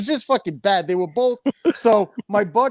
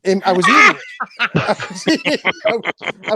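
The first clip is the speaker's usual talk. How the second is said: laughing through the words, higher; 4 semitones higher, 5 dB louder than usual. Real, not acted. Admitting to friends he ate the sandwich, laughing. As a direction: confess it while laughing.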